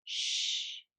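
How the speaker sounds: unvoiced hiss for silence; aunt shushing the child by the bird tree. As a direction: hush them near the birds. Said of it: "she says".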